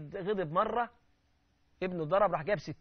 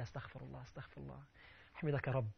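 very soft, lower murmur; murmured prayer; 5 semitones lower, 12 dB quieter than usual. Retold or imitated. Calm man praising God instead of retorting, murmuring. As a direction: murmur the praises under your breath. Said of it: "ويسبح الله".

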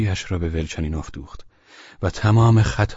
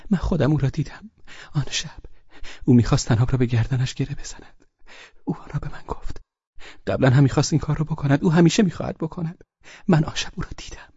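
put-on rushed, gasping child's voice, pitched higher; fast and breathless; 9 semitones higher, faster than usual. Liza telling Velchaninov in terror, breathless. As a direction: blurt it out panting with fear.

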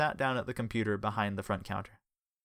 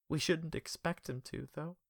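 softly; 7 semitones higher, 5 dB quieter, slower than usual. Acted. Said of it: "Richard said softly".

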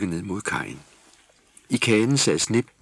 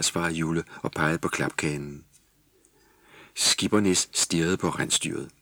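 ordinary delivery

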